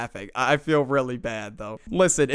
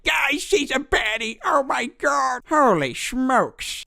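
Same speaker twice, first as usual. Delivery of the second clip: put-on high, breathless kid gushing over a girl; excited kid voice; 10 semitones higher, slower than usual. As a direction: squeal it like a smitten young boy.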